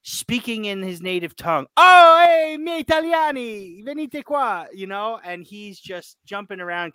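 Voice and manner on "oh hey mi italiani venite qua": high, loud mock call in Italian; excited call. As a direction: shout to the Italian players to come over. Retelling Koulibaly calling teammates again.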